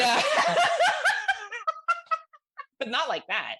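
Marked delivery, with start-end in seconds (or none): 0.3-2.2 long, loud, high-pitched laugh; real laughter. Real, not acted. Cracking up at own boyfriend joke, loud real laugh. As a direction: burst into a sustained high cackle.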